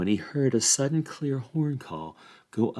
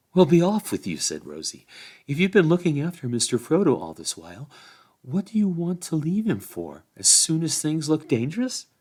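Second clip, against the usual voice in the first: a touch higher, scolding; lightly voiced chiding Rosie; 6 semitones higher than usual. A narrator's reading. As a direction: tell him off for leaving Frodo. Rosie chides Sam for leaving.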